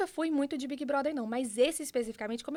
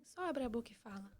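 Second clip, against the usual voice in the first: softer, gentle; loving voice; 10 dB quieter than usual. Retold or imitated. God's voice encouraging her, soft and loving. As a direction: say it tenderly. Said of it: "a voz com muito amor falava assim".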